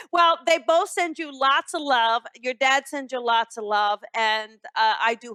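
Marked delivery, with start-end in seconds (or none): none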